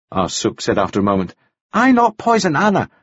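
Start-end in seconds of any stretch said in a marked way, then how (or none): 1.7-2.9 high, insistent broken English; accented protest. A narrator's reading. Ah Suk denies poisoning Anna, accented protest. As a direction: insist on innocence in a higher voice.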